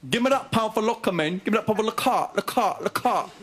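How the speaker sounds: put-on high street-kid slang voice; exaggerated cartoon intonation. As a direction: play a cocky ten-year-old demanding cash. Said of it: "comes up to me and goes".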